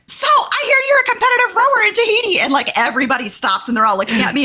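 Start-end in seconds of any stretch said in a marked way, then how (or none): 0.1-2.4 high, loud imitated woman; high mock voice. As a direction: mimic her high eager voice. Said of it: "She's like".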